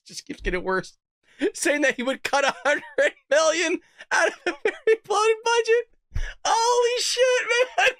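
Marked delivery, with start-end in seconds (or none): 1.5-7.9 climbing into a high, tearful laughing voice; real incredulous laughing; cracking up. Real, not acted. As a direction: read until laughter takes over. Reads budget cut, cracks up again.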